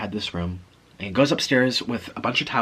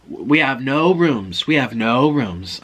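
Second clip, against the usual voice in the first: slow, louder retold refusal; emphatic refusal; 5 dB louder, slower than usual. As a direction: imitate a clerk spelling it out slowly. Desk clerk refusing late guest.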